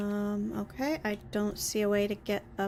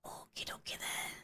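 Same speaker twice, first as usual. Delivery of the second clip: unvoiced whisper to self; 16 dB quieter than usual. Real, not acted. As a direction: murmur it under your breath. Muttered to self.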